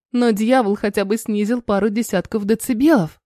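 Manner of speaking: ordinary delivery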